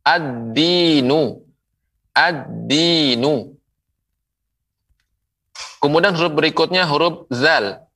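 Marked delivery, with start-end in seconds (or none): 0.0-3.5 very slow "Ad-dinu", held d and long i; doubled d. Shown as a demonstration. Quran teacher models the letter dal, doubled d. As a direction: draw out "ad-dinu", leaning on the d. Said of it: "berikutnya adalah huruf dal".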